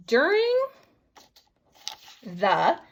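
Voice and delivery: high sing-song. Shown as a demonstration. Teacher sounding out word.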